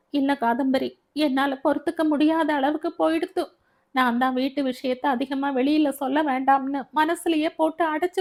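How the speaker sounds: high-pitched, aggrieved confiding; upset friend's voice, pitched high; distressed young friend. Acted. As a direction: unburden yourself to a friend on the phone.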